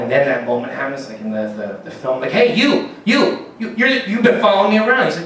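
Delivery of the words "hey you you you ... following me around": raised, louder accusing voice; accusing shout. Retold or imitated. A man confronting his stalker, loud accusing voice. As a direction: call him out loudly.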